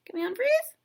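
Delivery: unfreeze asked with a big high rise; playful high question. Real, not acted. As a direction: sing the question up high, teasing. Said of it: "repeated playfully".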